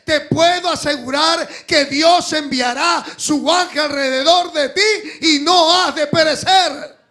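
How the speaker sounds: loud, high, impassioned pulpit shout; full-force preaching. Real, not acted. Preacher assuring the congregation they will not perish, full-force preaching. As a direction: build to a thundering, high-pitched promise.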